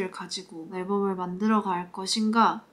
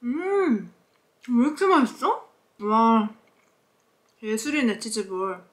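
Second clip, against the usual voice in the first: talking between bites; chewing while talking; 3 dB louder than usual. Real, not acted. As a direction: mouth partly full. Seen 1: Bitten cheese ball in hand, jaw moving, chewing after bite at -1.0.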